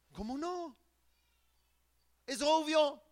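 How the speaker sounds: incredulous preacher exclaiming; incredulous outcry. Real, not acted. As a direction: cry out how can they not see. Preacher marvels at their blindness.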